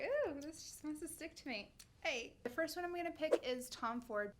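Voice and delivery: ordinary talk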